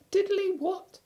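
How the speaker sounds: very high, small squeaky voice; tiny piglet talking up to his friend; Piglet's squeak. Acted. Piglet correcting Pooh, tiny squeaky voice.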